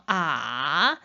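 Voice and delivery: 아 dipping low and coming back up; third tone. Shown as a demonstration. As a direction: scoop 아 down, then lift it. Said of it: "삼성". (아를 낮게 내렸다 올려, 삼성으로)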